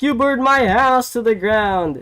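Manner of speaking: high, loud accusing shout as Swerve; mock angry yell. Swerve yelling back at Hangman, mock shout. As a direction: fling it back, hammed-up. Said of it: "And then Swerve was like".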